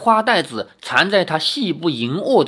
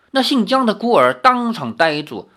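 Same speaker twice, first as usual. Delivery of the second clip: ordinary delivery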